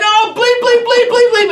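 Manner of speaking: very high, loud volley of mock curses; imitated yelling. Group clown's vulgar joking near silent devotion, yelling at full lungs. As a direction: belt out each bleep like a shouting clown. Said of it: "literally yelling this at the top of his lungs".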